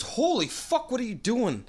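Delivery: high-pitched mock disbelief; astonished greeting. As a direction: act out a buddy's startled double take. Buddy startled to see escapee.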